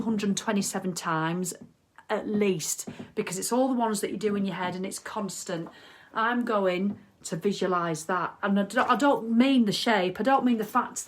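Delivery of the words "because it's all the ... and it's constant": ordinary delivery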